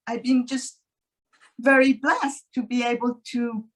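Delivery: ordinary talk, nothing marked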